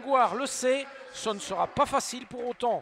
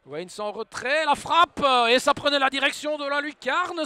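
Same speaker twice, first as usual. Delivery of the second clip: excited sports commentary; 3 semitones higher, 7 dB louder than usual. Real, not acted. Shot towards top corner.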